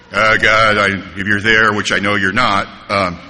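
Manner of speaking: low, halting mumbled-through prayer; reluctant prayer. Retold or imitated. Doubting newcomer praying alone, low and reluctant. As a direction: pray awkwardly, pitched well down.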